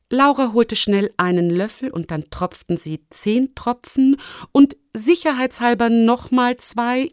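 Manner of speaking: usual speaking voice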